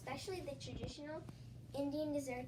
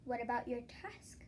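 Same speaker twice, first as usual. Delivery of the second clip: whispered; 2 semitones lower than usual. Acted. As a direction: whisper it like a secret idea. Vaisa speaks in whisper.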